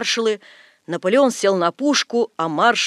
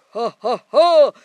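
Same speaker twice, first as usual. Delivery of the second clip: slow, loud, spaced-out villain laugh; fearsome stage laugh; 3 semitones higher, 8 dB louder, much slower than usual. A narrator's reading. Napoleon laughs terribly after Death leaves, fearsome stage laugh. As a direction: boom three defiant ha's. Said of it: "хохотал страшно Наполеон".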